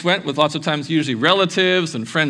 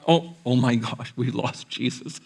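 dawning panic; 3 semitones lower, 4 dB quieter than usual. Retold or imitated. Parents realise Jesus is missing.

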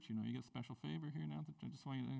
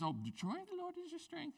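much higher, softer sing-song "Oh, the joy of the Lord is your strength."; sweet card voice; 12 semitones higher, 4 dB quieter, faster than usual. Retold or imitated. Pastor mimicking writing a card to kids, high soft sing-song. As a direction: coo it like a cheery greeting card. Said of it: "to the kids".

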